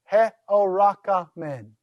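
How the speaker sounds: "He-o-ra-ka-men" slowly, syllables lightly linked; measured, divided "He-o-ra-ka-men". Shown as a demonstration. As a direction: sound out "He-o-ra-ka-men" in even syllables. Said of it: "allow a single consonant to go with".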